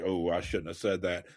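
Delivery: quiet, muttered to yourself; catching yourself after snapping at your partner, quiet; inner thought. Shown as a demonstration. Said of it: "and you think".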